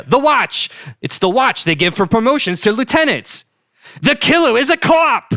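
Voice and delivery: high, loud Boston revelation shout; excited Boston accent. Acted. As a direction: yell the discovery in a Boston accent. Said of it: "my killer Boston accent".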